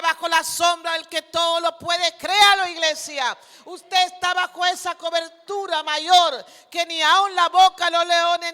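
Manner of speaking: loud, driving, fervent exhortation; full-force preaching. Real, not acted. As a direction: preach at the top of your voice to the church. (predicación encendida, a toda voz)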